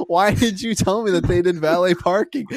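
high, loud exasperated accusation; exasperated demand. Retold or imitated. To dad about the made-up valet parking, loud exasperation. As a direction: confront dad in mock outrage. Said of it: "And I'm like".

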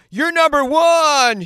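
very high, loud, stretched-out cheer; hammed-up gushing shout; mock cheerleading. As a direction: belt out over-the-top praise. Mocks praise the world won't give.

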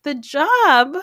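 high, loud startled question; shocked at the word job, voice jumping up; alarmed echo. Retold or imitated. Echoing the concierge's offer, loud high alarmed question.

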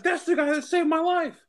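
high, eager mock exclaim; excited stranger. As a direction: point him out with glee. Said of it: "He's like".

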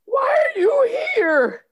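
wailing cry. Acted. Bartender wails at time travelers.

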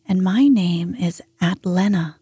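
sleepy storyteller reading gently; bedtime story narration. A narrator's reading. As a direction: lull them to sleep as you read.

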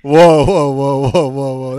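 loud, rapid run of whoas; startled objection. Real, not acted. Guest reacts to a caller's random question, startled. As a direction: cut in loudly, then ease off.

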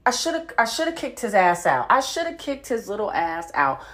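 ordinary delivery

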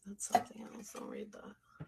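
soft whispered aside; whispering. Real, not acted. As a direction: breathe it under your breath. Aside while reading messages.

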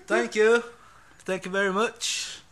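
loud, high imitation of stage thanks. Host copying the live performance just played, loud high impression. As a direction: project it like a rock star to the crowd. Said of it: "say like [name]".

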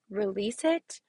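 ordinary talk

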